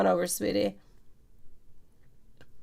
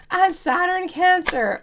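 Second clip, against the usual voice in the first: excited exclaim; 11 semitones higher, 4 dB louder than usual. Real, not acted. Excited reading a viewer's chart.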